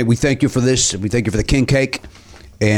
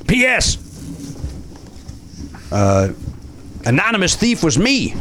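put-on Cajun accent; 6 semitones higher, 3 dB quieter than usual. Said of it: "you're still doing the accent".

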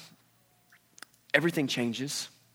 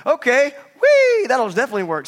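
sarcastic sailors; 10 semitones higher, 12 dB louder than usual. Retold or imitated. Sailors mock Jonah's throw-me-overboard plan, sarcastic high squeal. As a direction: ham up the sarcastic whee.

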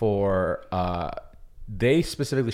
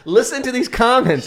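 laughing; 13 semitones higher, 6 dB louder than usual. Real, not acted. Laughing at partner.

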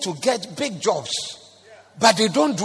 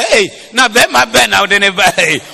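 mother's loud exclamation; 4 semitones higher, 10 dB louder than usual. Retold or imitated. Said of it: "Oba say".

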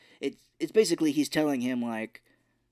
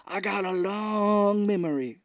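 high, drawn-out mock threat; Robin Williams; 7 semitones higher, slower than usual. Ousted host swears revenge on Smoochie, drawn-out mock menace. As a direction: stretch it into a hammed-up vow of revenge.

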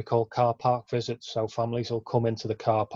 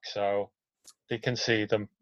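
ordinary talk, nothing marked

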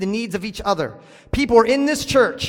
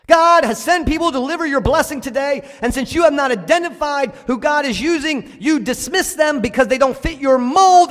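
higher and louder, driving each phrase home; preaching at full force; 5 semitones higher, 5 dB louder than usual. Real, not acted. Pastor rising to a climax before his church. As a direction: build the sermon to a peak.

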